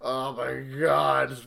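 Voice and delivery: slow, drawn-out weary groan; weary exasperation. Retold or imitated. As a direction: sigh it out, bored to death. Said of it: "I'm just like".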